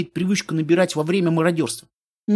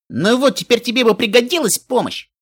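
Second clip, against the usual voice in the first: higher, teasing voice of a rescuer; cocky friend voice; 6 semitones higher than usual. Acted. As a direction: pop in teasingly, pitched up. Каламити вдруг появляется. (насмешливый голос спасителя)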